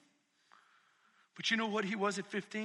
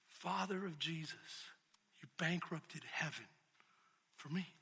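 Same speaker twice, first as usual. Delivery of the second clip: tender; 8 semitones lower, 11 dB quieter than usual. Retold or imitated. Preacher quoting a song line, soft and low. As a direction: hush it, almost a prayer. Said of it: "it destroys me every time I hear it".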